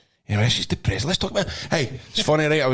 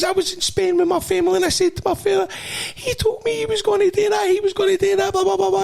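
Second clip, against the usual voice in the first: much higher, squeaky imitation of the young attendant; excited petrol guy. Teller imitating the petrol station boy, high and eager. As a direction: play the starstruck kid at the till.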